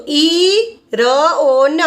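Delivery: each sound of "ઈ ર ઓ ન" held long and separate; "ઈ ર ઓ ન" in slow, drawn-out phonics. Shown as a demonstration. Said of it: "અને ન ને એન ને ન".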